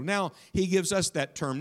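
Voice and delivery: ordinary talk, nothing marked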